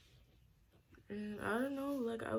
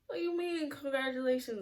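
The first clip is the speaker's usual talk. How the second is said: retold incredulous; 8 semitones higher than usual. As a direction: push the pitch up in mock disbelief. Retold disbelief at being congratulated.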